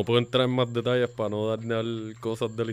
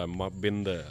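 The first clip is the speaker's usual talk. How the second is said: ordinary talk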